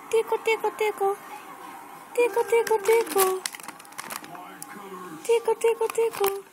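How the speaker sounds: high, sing-song tickle chant. Real, not acted. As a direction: chirp it up high while tickling.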